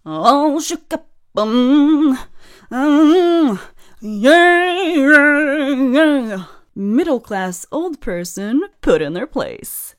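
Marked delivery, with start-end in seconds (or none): 0.0-6.5 mock flustered. Performed flustered rant.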